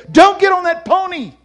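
very high, loud heavenly command; shouted warning. Retold or imitated. Voice from heaven repeated louder.

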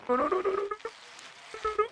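high, fading panicked squeal; panicked noes. Acted. Panicked falling.

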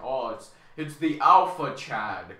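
loud, slow imitation of admirers; mock awe. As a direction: play the impressed crowd, drawn out and loud. Admirers react to his put-on voice.

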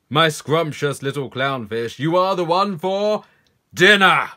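loud, greedy build to a triumphant cry; ravenous predator fish. Acted. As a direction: pounce on the prey, rising to a big finish. Predator pounces on its prey.

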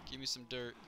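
normal talking voice

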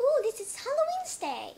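high, loud Halloween cheer; excited announcement. Acted. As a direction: announce it like a party.

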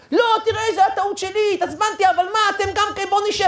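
high, pleading climb-down; imitated father giving in; flustered backtracking. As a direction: protest hurriedly in a high voice. Father suddenly backing down.

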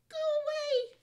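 high shrill yell; shouted. Acted. Monkey character shouts in story.

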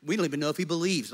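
ordinary talk

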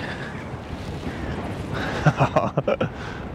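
soft rueful laugh; breathy laugh. Real, not acted. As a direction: laugh it off quietly. Laughs at own soaked boot.

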